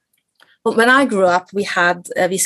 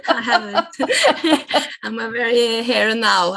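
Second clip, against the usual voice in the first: laughing; 4 semitones higher, slower than usual. Real, not acted. Admits she never plans her future.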